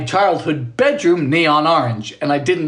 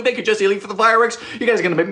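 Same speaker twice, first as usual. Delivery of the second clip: usual speaking voice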